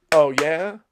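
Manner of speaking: sassy retort; mock comeback. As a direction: clap back, sassy. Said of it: "that's when I go".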